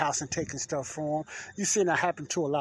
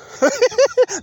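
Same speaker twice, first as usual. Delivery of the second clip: nervous laugh; 7 dB louder than usual. Real, not acted. Nervous laugh after the scare.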